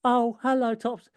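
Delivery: a hint of a meek little boy; Tim's voice. Shown as a demonstration. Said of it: "Tim is just".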